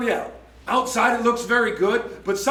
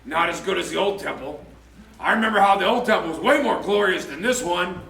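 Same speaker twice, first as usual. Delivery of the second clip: low, muttering complaint from the elders; sour, lowered, nostalgic grumble; grumbling old people; 5 semitones lower than usual. Retold or imitated. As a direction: grumble it like an old man remembering better days. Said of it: "they grumbled and complained".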